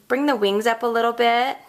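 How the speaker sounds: normal talking voice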